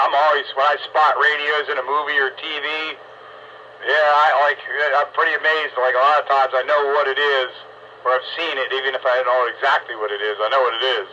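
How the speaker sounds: ordinary talk, nothing marked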